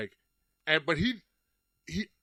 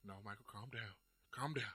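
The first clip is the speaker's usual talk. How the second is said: quiet self-talk; 3 semitones lower, 13 dB quieter than usual. Retold or imitated. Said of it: "I was like".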